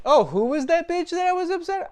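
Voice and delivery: very high, loud, airy mock voice; mock dazed guy. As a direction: squeal it like he has totally forgotten her. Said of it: "You'd be like".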